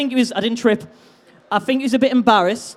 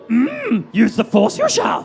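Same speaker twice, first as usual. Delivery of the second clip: demonstrating a put-on Yoda voice; drawn-out hum, then a creature voice rising at the end; slower than usual. Shown as a demonstration. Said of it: "I want you to go".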